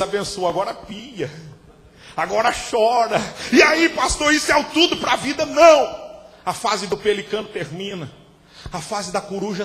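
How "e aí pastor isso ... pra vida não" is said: loud, high, shouting preacher; fiery pulpit preaching; preaching at full force. Acted. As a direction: drive it home like a revival preacher.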